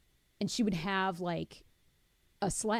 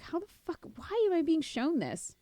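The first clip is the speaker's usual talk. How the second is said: exasperated disbelief; 10 semitones higher than usual. Retold or imitated. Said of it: "I'm like".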